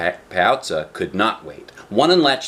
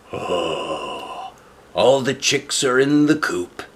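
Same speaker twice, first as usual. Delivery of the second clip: sleepy wolf voice; slower than usual. A narrator's reading. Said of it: "he said".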